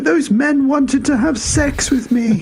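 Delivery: high, slow, whimpering mock sob; hammed-up high tearful whimper; mock crying. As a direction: whine it like a shaken man. Dan crying after jail.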